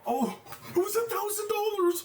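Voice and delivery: shrieking high with disbelief at the win; real excited outburst. Real, not acted. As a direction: burst out high and loud, thrilled. Big trading win realized.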